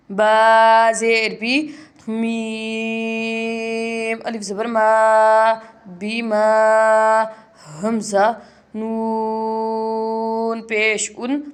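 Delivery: slow, drawn-out letter spelling; recitation spelling. Shown as a demonstration. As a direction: spell each letter and syllable slowly. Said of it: "spelling the verse slowly". (धीमी तिलावत वाले अंदाज़ में हिज्जे)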